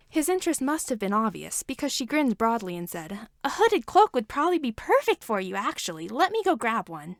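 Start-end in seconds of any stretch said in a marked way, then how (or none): 3.4-7.2 a hint of bubbly eagerness; bright, quick helper offering a fix; lightly voiced eager Entrapta. A narrator's reading. Entrapta offering Hordak a cloak, lightly eager.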